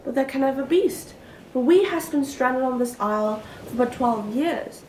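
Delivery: lovestruck maiden, wondering aloud in theatrical verse; earnest stage soliloquy, swelling from musing to ardent love; Miranda's soliloquy. Acted. Performs own Miranda soliloquy, then explains it.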